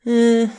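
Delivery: high, loud 'eh' of rejection; dismissive 'eh'. Real, not acted. Judging her own middle-ground threat, dismissive 'eh'.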